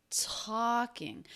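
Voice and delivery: talking with a strong first syllable and a weak second; first-syllable stress. Shown as a demonstration. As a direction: stress TALK in talking, drop off on -ing. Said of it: "that's the first syllable".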